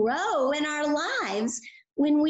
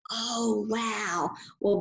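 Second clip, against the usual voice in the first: ordinary delivery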